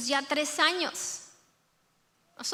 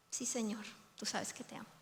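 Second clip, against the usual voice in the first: soft, near-whispered confession; humble hushed reply; 4 semitones lower, 14 dB quieter, faster than usual. Acted. Peter answering Jesus's question, hushed and humble. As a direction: barely breathe the answer, humbled.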